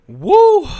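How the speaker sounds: loud high whoop; excited whoop. Real, not acted. Hyping the Porsche drama to viewers, a loud high whoop. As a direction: let out a loud, high cheer.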